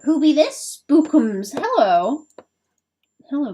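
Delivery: high, loud cooing pet voice; pet talk. Real, not acted. Greeting her pet.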